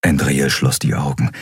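ordinary delivery

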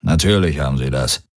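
low, muttered grumble; a touch of a low growl; grumbling to himself. A narrator's reading. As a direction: grumble it under your breath. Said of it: "brummte Darayos in sich hinein".

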